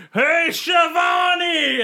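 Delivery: drawn-out high shout of a name across the arena; a wrestler bellowing slowly at a valet; mock yell. Wrestler yelling at Shivani ringside, drawn-out high shout. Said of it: "Tony yelling at".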